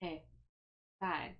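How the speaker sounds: normal talking voice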